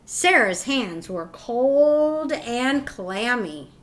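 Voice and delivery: ordinary delivery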